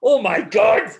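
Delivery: loud, high exclamation of disbelief; hammed-up horror, high and loud; mock shock. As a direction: cry out as if appalled. Acting out his shocked reaction.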